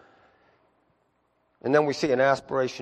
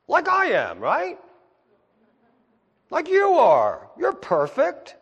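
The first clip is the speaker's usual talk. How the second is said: mock self-praise, much higher and a bit louder; sarcastic; 12 semitones higher, 4 dB louder, slower than usual. Pastor teasing the congregation, sarcastic and high. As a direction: tease them about thinking they're perfect.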